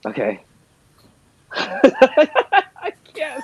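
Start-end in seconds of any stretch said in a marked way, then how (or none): 1.5-3.4 giggling through the words; real laughing. Real, not acted. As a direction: laugh helplessly while speaking. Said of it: "That's your regular laugh".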